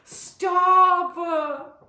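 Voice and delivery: loud, high, drawn-out "stop"; mock protest. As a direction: cry out in hammed-up protest. Mock cry of protest while commenting.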